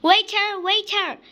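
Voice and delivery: loud, high call for the waiter; shouting waiter, waiter. A narrator's reading. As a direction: snap for the waiter across the room. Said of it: "clicking his fingers and shouting".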